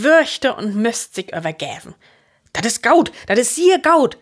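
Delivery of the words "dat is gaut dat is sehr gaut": high, loud emphatic approval. A narrator's reading. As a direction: insist firmly, louder and higher. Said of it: "säh Jochen mit Nadruck". (luud un mit Nadruck)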